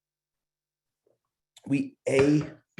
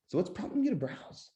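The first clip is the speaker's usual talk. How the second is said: softer, gently curious; soft curious question; 8 semitones higher, 7 dB quieter than usual. Shown as a demonstration. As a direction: ask it quietly, truly curious. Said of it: "bring your tonality down".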